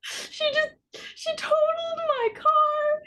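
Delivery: very high, drawn-out mock wail; mock crying. Telling friend about totaled car, mock crying. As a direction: whimper it slowly, hamming up the tears.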